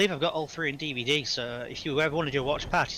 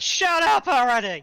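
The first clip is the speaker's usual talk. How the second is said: shouting; 12 semitones higher, 10 dB louder than usual. Retold or imitated. Hot Fuzz movie quote.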